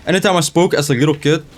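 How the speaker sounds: ordinary delivery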